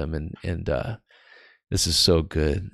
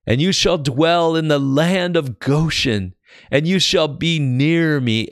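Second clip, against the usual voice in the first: raised, emphatic Bible reading; scripture reading; 4 semitones higher, 4 dB louder than usual. Acted. Preacher reading Joseph's message to his brothers, declaimed scripture reading.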